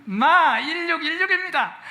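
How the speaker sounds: 경상도 사투리로 높고 느리게 "마 일육일육입니다"; Gyeongsang dialect. Shown as a demonstration. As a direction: say "마 일육일육입니다" the Gyeongsang way, high pitch and drawn out. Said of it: "경상도 사람들이 잘 말하는 경상도 말로".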